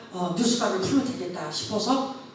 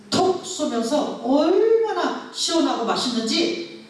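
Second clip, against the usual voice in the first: amazed delight; 6 semitones higher, slower than usual. Real, not acted. Praising the miraculous dongchimi taste.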